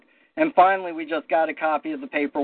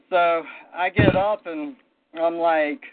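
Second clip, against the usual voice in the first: ordinary talk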